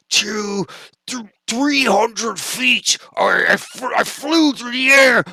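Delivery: high, loud yelling, words cracking with excitement; excited screaming. Acted. Bullbug screaming to Phil over the battle, excited high screaming. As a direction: scream the brag like an overjoyed friend. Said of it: "and he's screaming".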